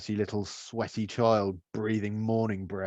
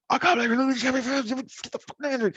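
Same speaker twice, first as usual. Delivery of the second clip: very high-pitched, garbled ranting; mock dad rage. Dad showing the talk misbehaving kids might get, raging. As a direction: act out a parent going mental, pitch shooting up. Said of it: "sometimes I'll rage".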